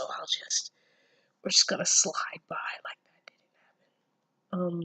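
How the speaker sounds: hushed, sheepish whisper, moving on; embarrassed whisper. Real, not acted. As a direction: whisper it like you hope nobody noticed. Brushing off her own tangent.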